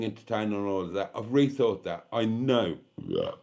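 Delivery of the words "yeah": low belched burp; a deep burp slipping out mid-sentence; wine burp. Real, not acted. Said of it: "Wine burp".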